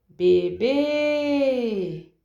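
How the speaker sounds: BEBÊ called high and loud, final BÊ held long; calling out at gate. Shown as a demonstration. Teacher demonstrates stressed syllable to students, calling BEBÊ with BÊ prolonged. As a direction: holler BEBÊ toward the house, stretching the last syllable. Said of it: "chamando essas palavras no portão".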